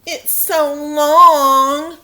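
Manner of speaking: slow, loud drawn-out teasing; mocking. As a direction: stretch it out to mock her. Classmate mocks her name.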